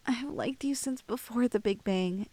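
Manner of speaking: normal talking voice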